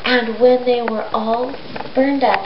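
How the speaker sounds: ordinary delivery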